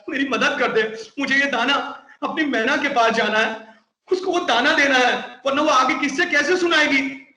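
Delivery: high, pleading parrot voice coaxing the carpenter. Acted. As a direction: sweetly beg the carpenter as a little parrot. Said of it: "बड़े प्यार से मनाते हुए".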